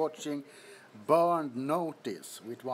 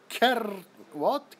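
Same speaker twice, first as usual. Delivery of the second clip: ordinary talk, nothing marked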